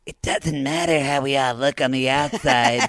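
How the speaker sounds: put-on voice, slowing on the last part; mocking quote. Co-host mocking Darcy's line about inner beauty, put-on voice. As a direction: recite it with hammed-up sincerity. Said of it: "I have another strong tea, quote and quotable".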